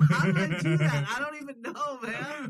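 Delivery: real laughter, voice shooting high; laughing amazement. Real, not acted. Guest has just guessed the host's jati right, laughing in amazement. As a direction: crack up at your own lucky guess.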